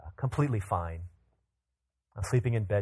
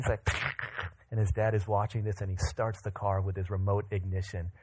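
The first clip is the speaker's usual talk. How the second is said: normal talking voice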